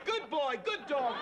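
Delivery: high, sing-song praise as if to a puppy; mocking talk to a little doggy; pet-owner coo. Host treats his co-host as a trained dog, high mocking coo.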